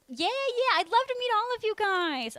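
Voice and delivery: excited. Real, not acted. Excited about meeting viewers.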